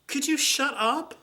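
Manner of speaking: high, slow, far-off mocking voice; pointed mock snap, slow and high; snippy retort. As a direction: sneer it out slowly and high. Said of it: "my response was".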